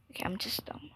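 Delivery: whispered "I'm just dumb", hushed and fed up; soft unvoiced self-scolding whisper. Real, not acted. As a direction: say it under your breath to yourself. Whispered take of repeated line.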